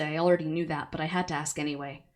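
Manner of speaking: plain reading voice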